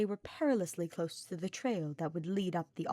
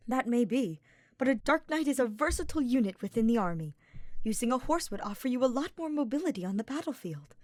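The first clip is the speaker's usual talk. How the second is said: higher, grand self-assured knight; boastful young nobleman; 5 semitones higher, 4 dB louder than usual. A narrator's reading. Ferdinand reassuring Hubert about riding, higher grand boast. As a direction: puff up, the great Ferdinand.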